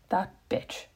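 a whispered aside, breathy and voiceless. Real, not acted. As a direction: mutter it under your breath, fond not mean. Affectionate aside about sister.

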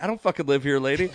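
acted-out irritated protest; retold exasperated retort. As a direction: throw it back in mock exasperation. Retold argument with heckling lady.

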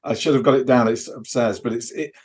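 usual speaking voice

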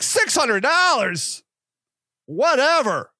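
high, drawn-out disbelief, then a dismissive "whatever"; incredulous. Retold or imitated. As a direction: echo the price back, stunned, then shrug it off. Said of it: "I'm like".

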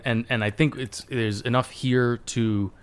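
ordinary talk, nothing marked

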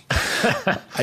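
ordinary talk

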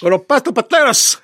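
put-on high, hammy Cuban accent; mock Scarface Cuban accent. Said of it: "Al Pacino doing a Cuban accent".

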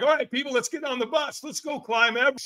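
high-pitched gung-ho announcement; rally the whole crowd for an adventure; fired-up rallying call. Retold or imitated. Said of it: "'Cause I'm like".

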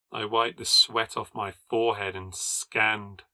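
ordinary delivery

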